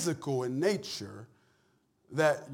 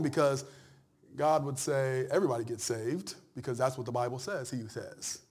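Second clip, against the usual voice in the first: usual speaking voice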